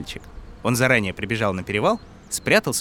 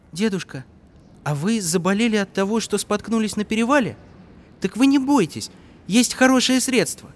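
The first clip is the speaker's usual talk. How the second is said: put-on light, higher boyish voice; a young boy talking kindly to grandpa; 10 semitones higher than usual.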